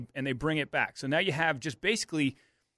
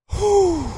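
loud, high exhale of relief; relieved whew; 8 dB louder than usual. Retold or imitated. Art bent over after landing, a loud high relieved whew. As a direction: blow it out like you just cheated death. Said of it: "Art is sitting like this going".

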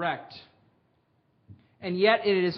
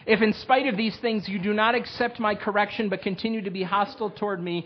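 louder, solemn proclaiming of the warnings; scripture reading; 3 semitones higher, 5 dB louder than usual. Acted. Pastor reading the curses to his congregation, solemn and loud. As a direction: read God's warnings with weight. Begins war passage reading.

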